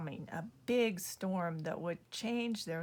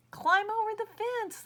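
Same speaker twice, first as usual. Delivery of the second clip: higher, louder kindly lady voice; nice lady; 13 semitones higher, 5 dB louder than usual. Acted. Lady at red house advising buffalo, kindly higher call. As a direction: call out helpfully like a kind neighbour.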